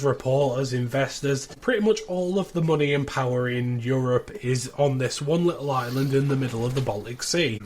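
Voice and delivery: normal talking voice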